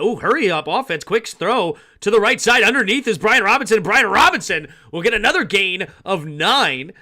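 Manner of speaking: loud, high, urgent football call; excited play-by-play. Real, not acted. Announcer as the offense hurries a quick throw, loud excited play-by-play. Calls hurry-up offense live.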